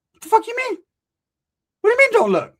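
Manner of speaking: high, loud mock outrage; indignant outburst. To the man who said don't look, high indignant yell. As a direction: exclaim it high in disbelief.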